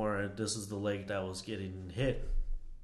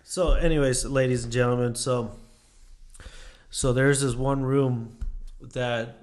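loud, slow showman announcer; 6 dB louder than usual. Acted. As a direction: present it like a haunted-tour host. Announces spooky room like host.

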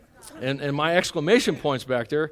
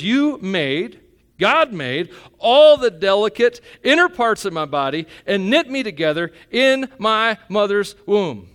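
louder, ringing out David's words; fervent scripture quote; 6 semitones higher, 9 dB louder than usual. Acted. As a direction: proclaim the psalm to the crowd. Quoting David while preaching.

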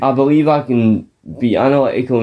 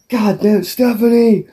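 mock yell; 10 semitones higher than usual. Man yelling after his storming-off wife, mock exasperated shout.

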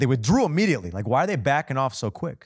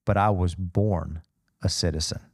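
slow and weighty, lower pitch; dramatic slow delivery; 5 semitones lower than usual. Shown as a demonstration. Preacher quoting Paul's reply, slow and dramatic. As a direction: land each word with a dramatic beat. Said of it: "Dramatic beat".

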